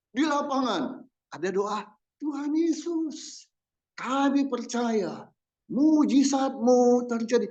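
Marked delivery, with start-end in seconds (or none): 2.2-7.5 much higher, slow, pleading cry; high, drawn-out mock revival prayer; mock fervent crusade prayer. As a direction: imitate a revival-field prayer leader. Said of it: "pokoknya teriak gitu ya".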